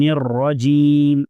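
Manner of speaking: الرجیم slow, with a thick, full ra; heavy ra. Shown as a demonstration. Teacher showing students the heavy ra, slowly. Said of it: "الرجیم کے اندر جو را ہے اسے بھی موٹا".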